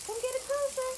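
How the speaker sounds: high, sweet coaxing to a pet. Real, not acted. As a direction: gently lure the bird closer. Coaxing a bird to come.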